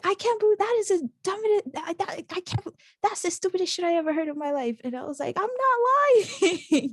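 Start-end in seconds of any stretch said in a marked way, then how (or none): 0.0-4.8 high, sputtering mock outrage; retold angry rant. Sister disbelieving the Harvard news, mock ranting. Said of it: "to yell at me actually". 5.4-6.9 high, loud, stretched-out mock protest. Retelling her denial to sister, hammed-up protest. Acted quarrel with disbelieving sister.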